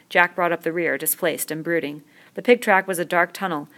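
plain reading voice

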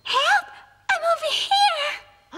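put-on trapped girl crying out for help. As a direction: call out desperately from a trap.

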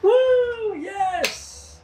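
high, loud burst of joy; excited cheer. Real, not acted. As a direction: cheer out the win. Celebrating a correct guess.

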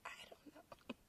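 breathy unvoiced whisper. Real, not acted. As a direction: whisper it with a laugh. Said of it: "I like to whisper sometimes".

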